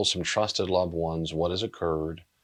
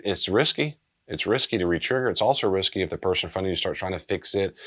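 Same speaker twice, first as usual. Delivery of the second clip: normal talking voice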